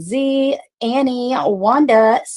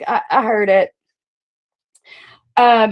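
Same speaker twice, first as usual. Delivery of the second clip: ordinary talk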